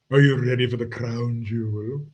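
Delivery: usual speaking voice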